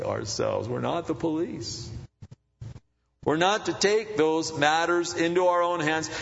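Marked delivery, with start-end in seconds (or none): 3.2-6.1 preaching at full force. Acted. Pastor preaching to the congregation, at full force.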